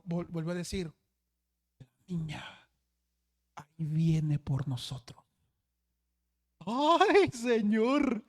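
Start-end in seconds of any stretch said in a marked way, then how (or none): 1.8-5.3 a hint of a sleepwalker, slow and lower; mild spooky sleep-talk. Sleepwalking boy warns the group, slow and low. 6.7-8.2 mock fright. Pastor's frightened exclamation.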